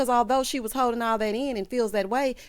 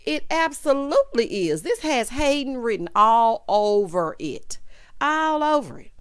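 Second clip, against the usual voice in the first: loud, emphatic declaration, drawn out; emphatic verdict; 2 semitones higher, 5 dB louder than usual. Real, not acted. Reviewer answering whether it is all about Zach's money, loud and emphatic. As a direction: declare it with full force, stretching the words.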